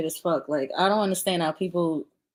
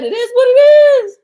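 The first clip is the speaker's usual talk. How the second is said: much higher and louder, a squealing parody; mock high squeal; 12 dB louder than usual. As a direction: throw his words back in a shrill mocking cry. Mocking his catchphrase, high and loud.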